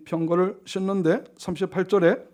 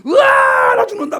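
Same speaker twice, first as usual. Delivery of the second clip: high, very loud mock death cry; hammed-up battlefield wail; dying scream; 12 dB louder, slower than usual. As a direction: scream out like a soldier cut down. Acts out a dying soldier.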